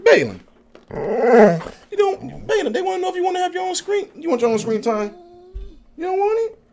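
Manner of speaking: sing-song up high at the dog; squeaky dog-talk; high pet voice. Real, not acted.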